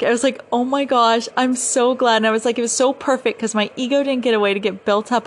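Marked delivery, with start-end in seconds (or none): 0.5-2.2 high, loud, gushing excitement; reenacted elation; super ecstatic. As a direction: gush with delight as you retell it. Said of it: "super ecstatic".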